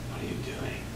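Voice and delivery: breathy whispered question. Shown as a demonstration. Teacher demoing a take to student, hushed whisper. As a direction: whisper it like a secret. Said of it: "How about this?".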